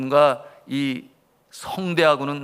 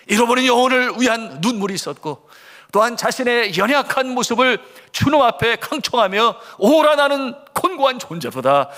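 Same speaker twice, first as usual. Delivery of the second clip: high, forceful preacher's delivery; impassioned preaching; 9 semitones higher, 5 dB louder than usual. Acted. Preacher urging the congregation that we are weaker than children, impassioned. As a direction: press it home in a raised, urgent pitch.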